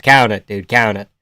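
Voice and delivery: ordinary talk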